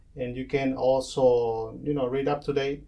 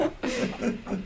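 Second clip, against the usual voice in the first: high laugh after the punchline; 9 semitones higher, 6 dB quieter than usual. Real, not acted. Host laughing at the coronavirus joke, high laugh.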